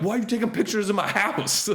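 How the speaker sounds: high, hammed-up outraged voice; mock angry homeowner. Comedian mimics a suspicious homeowner, laughing, high mock outrage. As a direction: squeak out the homeowner's indignation with a laugh.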